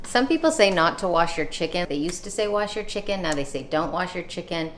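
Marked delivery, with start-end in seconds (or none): none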